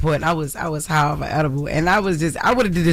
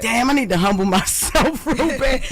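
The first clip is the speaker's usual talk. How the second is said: laughing at himself; 6 semitones higher than usual. Retold or imitated. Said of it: "and I was like".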